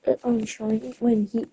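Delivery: ordinary talk, nothing marked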